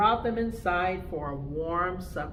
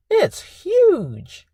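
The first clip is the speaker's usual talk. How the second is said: excited; 12 semitones higher, 12 dB louder than usual. Acted. Whale jumping out of water.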